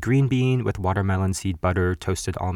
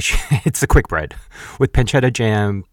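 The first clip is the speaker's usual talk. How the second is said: ordinary talk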